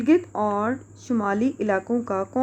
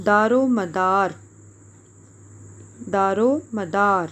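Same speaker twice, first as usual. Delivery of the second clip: slow dictation; slower than usual. Shown as a demonstration. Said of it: "dictating a hard word".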